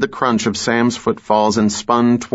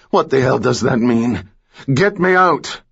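angry; 4 semitones higher than usual. Acted. Trapped villain demands rescue.

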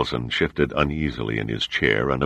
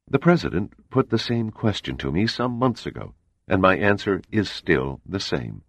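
higher, measured voice of a composed spy chief; cool CIA agent; 6 semitones higher than usual. A narrator's reading. CIA man explains the murder to the admiral, composed. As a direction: lay out the facts coolly and evenly.

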